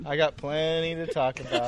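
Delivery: soft grumbling repeat; muttered aside. Shown as a demonstration. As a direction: mutter it to yourself. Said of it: "repeats the line".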